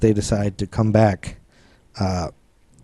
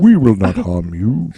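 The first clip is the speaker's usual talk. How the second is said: very slow, hammed-up African accent; 3 dB louder, slower than usual. Alien trying again to reassure abductee, slow African accent. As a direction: stretch it out in a bad African accent. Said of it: "like, this African dialect".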